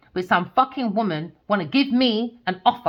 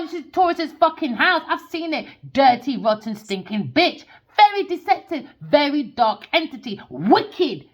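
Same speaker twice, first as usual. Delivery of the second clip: normal talking voice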